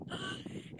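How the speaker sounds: sharp, voiceless gasp of horror; appalled gasp. Acted. Reacting to a birth among dirt and cows, appalled gasp. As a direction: suck in a shocked breath.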